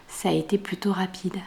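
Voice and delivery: soft unvoiced whisper. Real, not acted. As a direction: drop to a whisper. Aside while showing socks.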